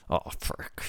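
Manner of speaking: clenched-teeth hiss; through gritted teeth. Shown as a demonstration. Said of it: "if you say something through gritted teeth".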